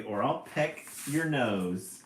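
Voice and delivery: loud, slow threat; mother bird threatens the cat, loud and slow; threatening. A narrator's reading. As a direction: spell out the threat. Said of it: "screams Mama Jay".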